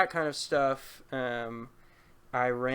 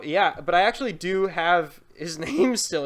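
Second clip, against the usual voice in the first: laughing; 4 semitones higher, 8 dB louder than usual. Real, not acted. Laughing confession of a prank.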